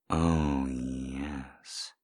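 usual speaking voice

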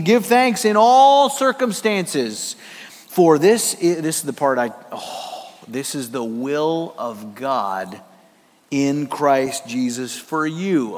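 slow, reverent verse reading; solemn scripture reading. Real, not acted. Pastor reading First Thessalonians to congregation, slow and reverent. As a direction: read the verse slowly and gravely. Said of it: "First Thessalonians five eighteen".

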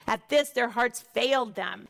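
usual speaking voice

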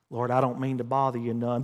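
soft, low, apologetic mock prayer; falsely humble. Preacher mimics timid believer praying to the Lord, falsely humble. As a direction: play meek and afraid to impose. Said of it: "Get that false humility out".

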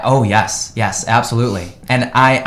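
usual speaking voice

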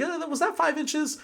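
a gleeful player asking with delight; eager opponent. Retold or imitated. Happy opponent eagerly measuring moves.

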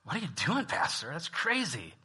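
a hint of baffled disbelief at the pastor; imitating a stunned congregant; shocked churchgoer. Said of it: "and you'd be like".

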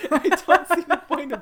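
laughing loudly through words. Real, not acted. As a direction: laugh while saying it. Laughing at Bran's exit.

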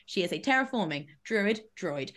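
ordinary delivery

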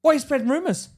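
high, loud accusing shout; angry CEO. Retold or imitated. CEO angrily accusing employee of rumors, loud and high. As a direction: bark the accusation at him. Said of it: "He's like".

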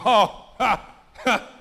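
loud, acted scoffing laugh; imitated incredulous guffaw; mock laughter. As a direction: laugh off the invitation in big separate ha's. Acts man laughing off church invitation.

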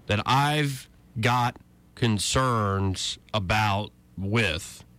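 "concerns about with" spelled out slowly to highlight awkward wording; slow, pointed repetition. Shown as a demonstration. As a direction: drag out "that I've got concerns about with", pausing between phrases. Quote repeated for its awkward wording.

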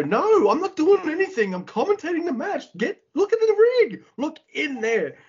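high-pitched exasperated yelling, acted out. Commentator protesting his innocence to the referee, imitated high-pitched yelling. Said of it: "Kevin's yelling at Kenta Sato".

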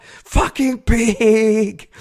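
high, loud, long drawn-out yell; yelled insult. Retold or imitated. Cyclist further off from the litterer, drawn-out yell. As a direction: holler it slowly from further away. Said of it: "I yelled out".

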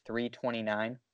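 normal talking voice

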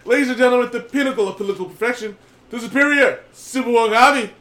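loud announcer introduction; ringmaster. Acted. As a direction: hype up the contestant. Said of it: "I am your host".

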